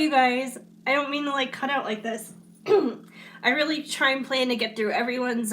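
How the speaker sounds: ordinary delivery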